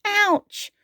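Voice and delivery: high yelp of "ouch"; acted cry of sudden toothache; high little dinosaur yelp; crying out in pain. A narrator's reading. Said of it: "he cried".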